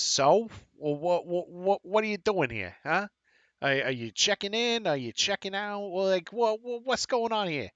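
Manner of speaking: high-pitched, pushy Boston clerk; Bostonian accent. Acted. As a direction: pepper them with questions in a Boston accent. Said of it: "a Bostonian accent".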